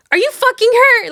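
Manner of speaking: very high-pitched imitated accusation; mock incredulous demand. Rick on the phone, guessing at once, incredulous. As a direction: blurt the question high, as if catching him out.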